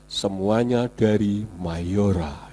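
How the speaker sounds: rendah, pelan dan berat seperti pengisi suara iklan; suara iklan Mayora. Retold or imitated. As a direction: tirukan suara iklan lama yang mantap. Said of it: "Suaranya seperti orang, kalau dulu ada iklan". (suara iklan Mayora, rendah dan pelan)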